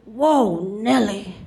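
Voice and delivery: a hint of an old TSA agent; understated old-timer impression.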